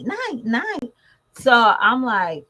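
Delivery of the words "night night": lightly voiced toddler, a bit higher; hint of a little boy's sleepy night night; toddler saying night. Mother imitating her toddler's goodnight, lightly higher. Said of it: "he sounded like he said night".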